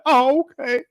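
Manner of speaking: high, hammed-up tearful whine; mock crying. Bishop mimics the crying sister, high mock sob. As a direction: parody her blubbering a little. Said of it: "don't cry like".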